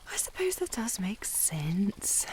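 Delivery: slow, hushed concession; slow whisper. Acted. Lady conceding his excuse, in a slow whisper. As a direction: whisper it slowly, half to yourself.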